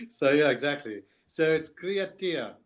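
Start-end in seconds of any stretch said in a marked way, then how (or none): 1.7-2.5 "Creatia" drawn out slowly, higher, as a model to copy; "Creatia" said slowly the South African way; South African pronunciation. Shown as a demonstration. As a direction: show how "Creatia" sounds in a South African accent. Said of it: "how do I say it again".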